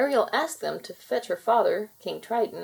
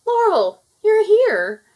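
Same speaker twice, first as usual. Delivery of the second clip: much higher and louder, a startled cry; gasping surprise; 13 semitones higher, 14 dB louder, slower than usual. A narrator's reading. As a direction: she can't believe her eyes, so call it out. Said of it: "Ariel gasped".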